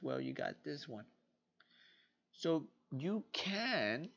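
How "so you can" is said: drawn-out, very slow sign-off; slow tagline. Acted. Closing the video with a slow drawn-out pitch for Expanded. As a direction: stretch each word like a slogan.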